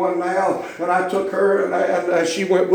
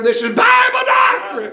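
much higher, punched and loud; preaching shout; 3 dB louder than usual. Real, not acted. Preacher defending his doctrine to the congregation, shouting. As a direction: slam it home as truth. Preacher answers doubting looks.

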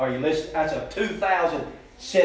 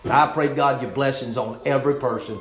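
earnest pastoral prayer, rising louder on the pleas; closing prayer; 5 dB louder than usual. Acted. Pastor prays over the ladies and their homes, earnest and pleading. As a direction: pray it out over the room, pleading.

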